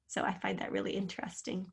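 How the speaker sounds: breathy aside, fast and hushed; whispered aside. Real, not acted. Teacher whispers a fun fact to the class. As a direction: whisper it as a little secret. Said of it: "aside to the class".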